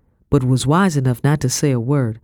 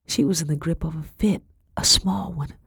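hushed whisper; 4 dB quieter than usual. A narrator's reading. Narrator drops to whisper.

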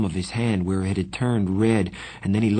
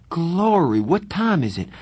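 high startled waking; 9 semitones higher, 4 dB louder than usual. A narrator's reading. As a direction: jolt awake in surprise. Startled awake.

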